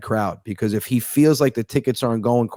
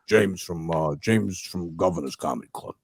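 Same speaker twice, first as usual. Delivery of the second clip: low, subdued phone-caller voice; club owner on phone; 6 semitones lower, 7 dB quieter than usual. Retold or imitated. Club owner calling a comic at work, low and understated. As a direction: imitate the owner in a low, softer voice. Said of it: "And he's like".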